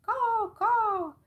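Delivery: loud, high-pitched crow caws, drawn out; cawing crow. A narrator's reading. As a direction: caw the greeting like a big crow. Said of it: "He could not say it any better".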